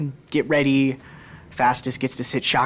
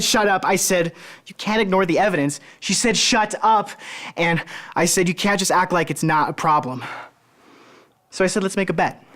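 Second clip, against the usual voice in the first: higher, agitated back-and-forth quarrel; acted sibling row, pitched up and pressing; heated argument; 6 semitones higher than usual. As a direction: retell the fight with rising urgency.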